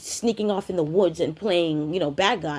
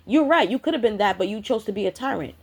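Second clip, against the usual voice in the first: usual speaking voice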